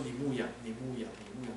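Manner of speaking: soft, fading charge; gentle command. Acted. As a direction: say it tenderly, almost a whisper. Jesus gently commissioning Peter. (耶稣温柔轻声地吩咐)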